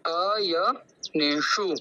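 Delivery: अयनेषु drawn out slowly, retroflex ष clear; slow demonstration. Shown as a demonstration. Teacher repeats the word for students, slow demo. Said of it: "एक बार मैं दोहराता हूँ".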